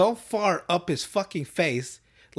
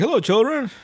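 higher, louder cartoon cook greeting; Chef; 8 semitones higher, 5 dB louder than usual. Cook greeting kids at the counter, imperfect impression. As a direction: attempt a booming character hello, not quite convincing. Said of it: "I wish I could do a good voice".

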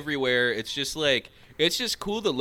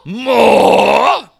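mock begging yell; 8 semitones higher, 13 dB louder than usual. Joining Oliver Twist bit.

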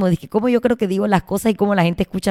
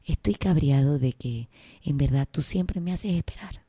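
softer, lower, smooth podcaster calm even while angry; calm podcast host voice; 5 semitones lower, 7 dB quieter than usual. Retold or imitated. Imitating a podcast host to a partner, soft and calm. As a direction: complain like a soothing podcast host. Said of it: "hablar como la man de Despertando Podcast".

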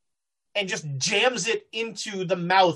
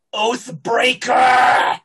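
loud, long-drawn accusing shout; Nitocris accusing; 3 semitones higher, 8 dB louder, much slower than usual. Acted. Nitocris turning on her attacker, loud drawn-out shout. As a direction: roar it, stretching the final syllable. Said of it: "her head immediately snaps around and she says".

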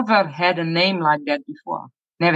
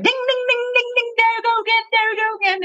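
high fast sing-song ding; playful high chiming, rushed at the end; imitated bell ding; much faster than usual. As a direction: chime it like a game-show bell. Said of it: "he was like".

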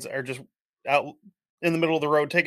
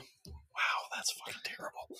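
shocked whisper; 15 dB quieter, slower than usual. Real, not acted. Shocked at a Facebook post.

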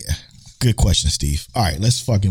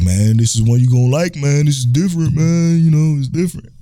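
higher-pitched, pleading mock pitch; imitating insistent friend; 8 semitones higher than usual. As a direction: push the recommendation like an insistent buddy.

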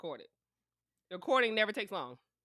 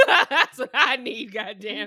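louder, laughing demand; 5 semitones higher, 15 dB louder, slower than usual. Real, not acted. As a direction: laugh while insisting on it. Laughing demand for sponsor money.

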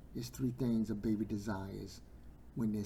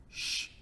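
hushing shush. Acted. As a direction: hush someone down. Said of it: "didn't want him to say nothing".

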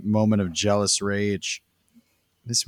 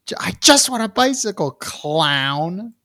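very high, quick, squeaky imitation; mock demanding kid; 12 semitones higher than usual. Host re-quotes the kid to tease, mock high voice. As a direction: mimic a bratty kid, pitched way up.

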